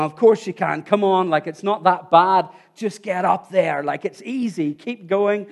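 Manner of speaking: loud, impatient urging in imitation; retold shouting up. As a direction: act out calling encouragement from below. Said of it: "she's shouting up".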